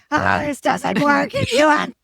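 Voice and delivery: wife imitating her angry husband, a hammed-up irritated outburst. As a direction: play the husband barking in frustration. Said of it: "his tone directed to me isn't".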